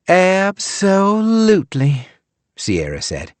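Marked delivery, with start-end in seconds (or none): 0.1-2.1 slow, every syllable drawn out; stretched syllables. A narrator's reading. Drunk guest stretching out a word she fears mispronouncing. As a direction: say it carefully as if afraid to mispronounce. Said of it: "stretching out all the syllables".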